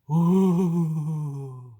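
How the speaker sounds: loud drawn-out ghostly ooh; spooky ooh. Acted. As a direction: moan it like a ghost. Spooky ending to ghost story.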